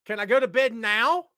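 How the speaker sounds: mock whiny Sandy. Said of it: "Sandy's like".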